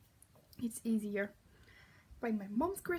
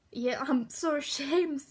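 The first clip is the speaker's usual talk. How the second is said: mock ashamed; 7 dB louder, slower than usual. Real, not acted. Mock shame at own accent.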